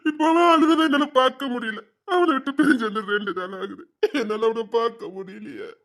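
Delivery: wailing lament with sobs between phrases; crying. Acted. Father unable to bear a loved one's suffering, crying. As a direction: cry out in anguish, then sink away. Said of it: "உட்கார்ந்து அழுதுட்டு இருக்கீங்க".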